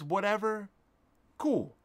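ordinary talk, nothing marked

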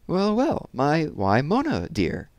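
lightly voiced foreign old lady, slowing at the end; old lady, foreign accent. A narrator's reading. Old lady greets a girl at the gallery, faint foreign accent. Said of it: "in her deep, cavernous voice with its faint foreign accent".

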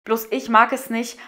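ordinary delivery

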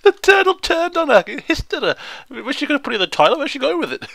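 high, incredulous, amused outburst; high-pitched, astonished and tickled; amused disbelief. Real, not acted. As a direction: exclaim it on the edge of laughter. Cracking up over the turtle.

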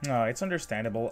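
usual speaking voice

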